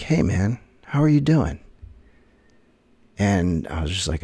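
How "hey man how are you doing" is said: cheery greeting. Retold or imitated. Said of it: "And they're like".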